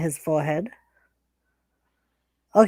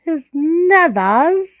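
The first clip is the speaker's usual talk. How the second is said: slow echo; 7 semitones higher, 4 dB louder, much slower than usual. Real, not acted. Echoing funny word.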